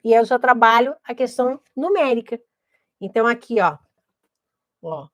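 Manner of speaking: ordinary talk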